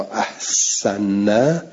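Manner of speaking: احسن stretched very slowly as ahsana, rising at the end; with fath on the hamza. Shown as a demonstration. Teacher spelling out the reading for students, very slow. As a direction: draw out احسن syllable by syllable, ah-sa-na. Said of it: "احسن را به معنی اسلمه".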